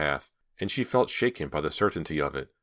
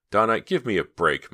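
a touch of pleading, a little louder; 5 semitones higher, 6 dB louder than usual. A narrator's reading. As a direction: beg her friend to drop it, lightly voiced. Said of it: "Myra pleaded".

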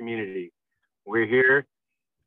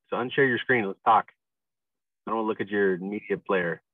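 ordinary talk

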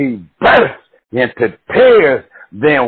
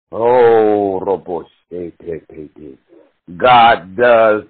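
ordinary delivery

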